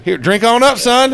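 high, friendly coach offering a drink; Spurrier imitation. Coach offering a drink to his old player, imitated high. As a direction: hand it over with a grin. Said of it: "And he says".

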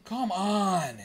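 loud, high cry of protest; frustrated shout. Real, not acted. Fan watching the hockey game, frustrated shout.

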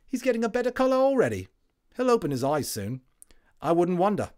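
cheery London Tommy; cockney soldier. A narrator's reading. Tommy voiced.

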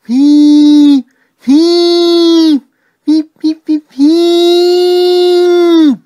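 very high, loud "whee" shrieks; long piercing ghostly train-whistle squeals; shrieked whistle. A narrator's reading. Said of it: "he shrieked".

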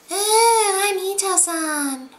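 high, drawn-out caricature voice; slow, high-pitched mock introduction; mocking Ito. Reviewer mocking the sly Ito introducing himself, high caricature voice.

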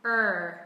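Er with lips pushed forward and tongue curled; American Er with rounded lips; r-colored er. Shown as a demonstration. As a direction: curl the tongue back for Er. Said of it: "lưỡi chúng ta cũng sẽ uốn cong".

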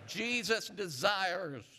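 slow rising call to the church. Shown as a demonstration. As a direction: lift it and stretch it so they echo back. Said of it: "Say it again".

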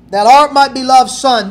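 the preacher booming the Father's words; proclaimed loud. Acted. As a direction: call it out like a declaration from heaven.

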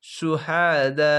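সুহাদা with long, slowly drawn "aa" vowels; four-alif elongation. Shown as a demonstration. Teacher showing the long madd, সুহাদা with greatly lengthened vowels. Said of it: "এখানে চার আলিফ টান".

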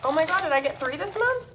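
high, excited surprise; surprised. Real, not acted. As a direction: gasp at an unexpected extra. Surprise opening the box.

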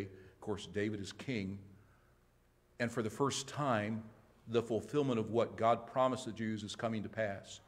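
ordinary talk

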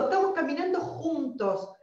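usual speaking voice

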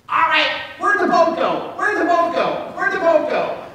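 high, loud puzzled parrot squawk. Acted. Parrot in the lifeboat asks the magician, high loud squawk. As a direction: squawk the punchline, baffled. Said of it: "the parrot says".

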